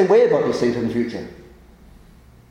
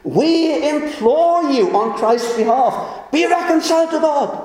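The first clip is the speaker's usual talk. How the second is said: pleading; 6 semitones higher, slower than usual. Acted. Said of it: "were pleading through us".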